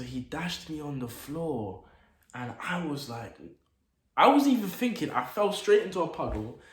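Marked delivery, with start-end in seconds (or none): 4.2-6.6 sudden high, loud burst of shock; startled exclaim. Retold or imitated. Telling friends how he got slammed, shocked high burst. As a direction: blurt it out like it just happened.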